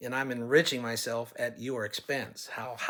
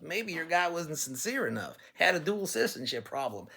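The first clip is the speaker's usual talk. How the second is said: ordinary talk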